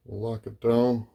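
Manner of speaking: usual speaking voice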